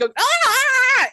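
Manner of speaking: high, loud mock kid scream; imitated shrieking of a child in someone's arms; imitated child's wail. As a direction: squeal like a squirming child being carried. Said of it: "carrying this child who's like".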